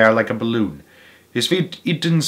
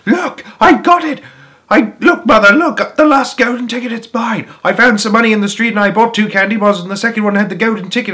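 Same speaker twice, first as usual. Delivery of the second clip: high, loud and rushing; breathless excitement; 9 semitones higher, 5 dB louder than usual. A narrator's reading. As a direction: burst in with the news. Charlie bursting with news.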